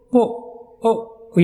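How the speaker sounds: پو او stretched very slowly; drawn-out vowel. Shown as a demonstration. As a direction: model پو او, drawing each sound out. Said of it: "یہ sound آتی ہے". (پو، او کو بہت کھینچ کر)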